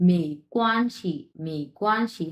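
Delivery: "মে কোয়ানশি" measured, ending on a light "শি"; careful tone model. Shown as a demonstration. Teacher sums up the tones, careful repeated model. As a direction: keep syllables distinct, release "শি". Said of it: "তারপর neutral tone ধরেই ছেড়ে দিলাম".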